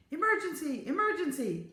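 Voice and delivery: urgent, high-pitched cry for help; calling out. A narrator's reading. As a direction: call the alarm across a building. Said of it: "the voice was calling".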